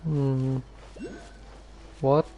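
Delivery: ordinary talk, nothing marked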